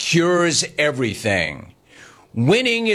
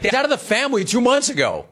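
mocking the neighbours; 8 semitones higher, faster than usual. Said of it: "Quote, like".

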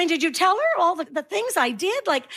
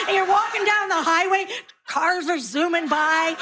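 ordinary talk, nothing marked

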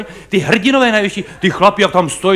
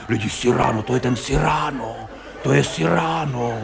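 hushed, excited murmur of a spectator; quiet awestruck theatregoer; awed hush; 6 semitones lower, 7 dB quieter than usual. Retold or imitated. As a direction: breathe the name like a star has appeared.

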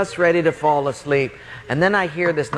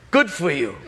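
sarcastic retort; 3 semitones higher than usual. Acted. Sarcastic retort to sleepless roommate.

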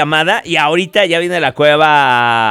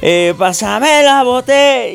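party shout; 7 semitones higher, slower than usual. Retold or imitated. Drunk partygoer calling out.

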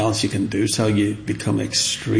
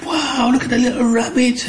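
put-on high, excited kid's voice; excited child; 13 semitones higher than usual. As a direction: squeal it like a delighted child. Child self shows his carving.

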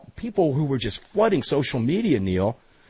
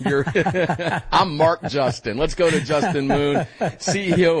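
laughing; 4 semitones higher than usual. Real, not acted. Host laughs at the name mix-up.

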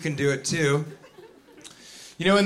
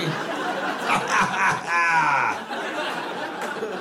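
self-pleased fake laugh. Greeter laughing at own pee joke, hammed-up.

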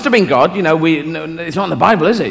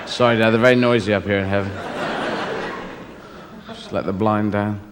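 low, softer aside as God; James Mason; 7 semitones lower, 7 dB quieter than usual. Acted. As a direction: apologise quietly in an English actor's voice. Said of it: "this James Mason impression".

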